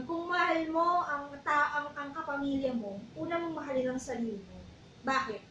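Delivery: ordinary talk, nothing marked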